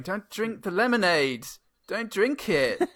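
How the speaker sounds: high, drawn-out mock plea; imitated desperate warning, pitched high; acted pleading. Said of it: "with Eddie Fanning, I think, going".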